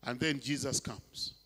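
normal talking voice

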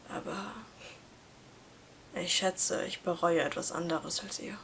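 quiet, sad goodbye to the friendship; soft confession. A narrator's reading. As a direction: let it out gently, resigned.

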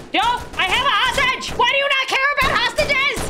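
high, indignant yelling at the police; outraged high-pitched plea; panicked shouting. Pretend. Calling out to game police.

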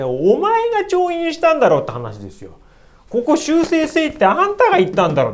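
loud, high exasperated accusing; indignant outburst. Real, not acted. Lecturer railing at the lying emperor, loud and high. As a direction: rail at the emperor.

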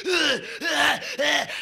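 hammed-up yelps; mock grunts. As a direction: yelp like a wild singer. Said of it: "He's like".

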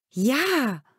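high, loud eager "Ja"; enthusiastic. A narrator's reading. Michael eagerly answering his father about the ring. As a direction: burst out in delight. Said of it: "ruft Michael begeistert".

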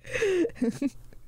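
short high giggle; real high-pitched chuckle; amused laugh. Real, not acted. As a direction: giggle at your own confusion. Amused at own confusion.

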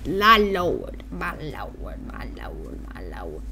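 very soft, croaky repeated mutter; hushed muttering. Real, not acted. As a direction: fade down into a near-whisper. Idle muttered catchphrase repeated.

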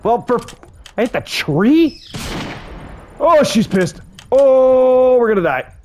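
high, loud panicked cry; high frantic shout of alarm at the angry T-Rex; panicked yelling. Real, not acted. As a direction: yell it as she charges. T-Rex charges him.

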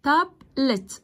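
"تابلت" broken as tab, pause, let; two split syllables. Shown as a demonstration. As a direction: say "تابلت" in two separate beats. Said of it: "الكلمات اللي على مقطعين زي ايه". ("تابلت" مقطعين منفصلين تاب ولت)

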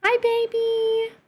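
high, slow cooing pet voice. Real, not acted. Baby talk to the cat.

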